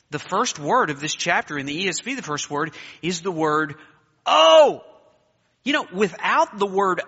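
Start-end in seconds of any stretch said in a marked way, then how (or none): 4.3-4.8 "oh." called out high and loud; ringing oh. Shown as a demonstration. Preacher lifting the word for the church, ringing "oh.".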